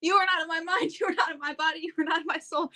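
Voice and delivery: high-pitched retold panic chant; frantically ward it off, half laughing at yourself; mock-panicked mantra, pitched high; freaking out. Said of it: "I was freaking out".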